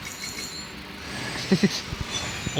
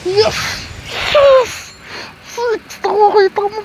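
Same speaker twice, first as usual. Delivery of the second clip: much higher, louder yes; elated shout; 11 semitones higher, 4 dB louder, slower than usual. Real, not acted. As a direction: scream with joy at the win. Finally got the spear. (sumisigaw sa tuwa)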